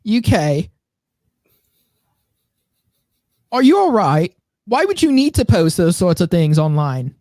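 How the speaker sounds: high-pitched British scolding put on; mock British accent. Host mocking British reaction to online posts, mock British accent. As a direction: play a prim, disapproving Brit.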